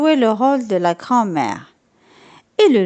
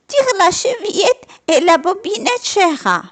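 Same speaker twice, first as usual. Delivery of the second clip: put-on higher, gentle old lady; grandmother's voice; 9 semitones higher than usual. A narrator's reading. Grandmother in bed telling the visitor to come in. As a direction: tell her how to open the door kindly. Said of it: "lui a dit la grand-mère".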